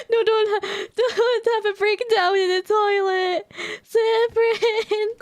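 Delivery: gasp, then break into a frantic plea; hammed-up panic calling out Severin; panicked outburst. Character panics in toilet.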